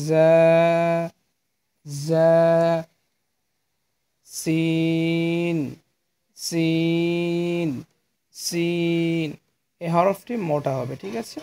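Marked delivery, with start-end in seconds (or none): 0.1-2.3 "জা" with buzzed z and held vowel; buzzing consonant. Shown as a demonstration. Teacher answers how it is pronounced, "জা" buzzed and held. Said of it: "তাহলে কি উচ্চারণ হবে এটা". 4.3-9.3 "সিন" with a hissed s and very long vowel; lower-teeth sibilant. Shown as a demonstration. Teacher demonstrates the letter to learners, "সিন" sibilant and drawn out. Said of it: "এই দুই দাঁতের গোড়ার সঙ্গে লাগিয়ে".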